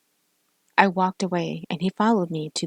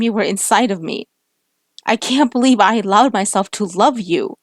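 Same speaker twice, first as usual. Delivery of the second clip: loud, raised, wounded shouting; angry tearful outburst; 4 semitones higher, 7 dB louder than usual. Acted. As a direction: hurl every word at him through tears. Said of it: "as I began crying".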